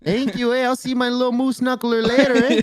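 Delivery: hammed-up Canadian accent, high and sing-song. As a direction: overdo the friendly Canadian with eh. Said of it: "Come to Canada".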